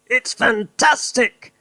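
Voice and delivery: high, slow excited cry; Mister Cranky shouts in wonder. Acted. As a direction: shout it with glee. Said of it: "Mister Cranky shouted".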